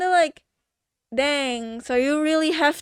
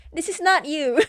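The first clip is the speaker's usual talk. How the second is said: giggling mock-dramatic plea; mocking through a laugh; 3 semitones higher than usual. Mocking the hero's plea, laughing through it. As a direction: laugh while quoting the line. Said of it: "made fun of it".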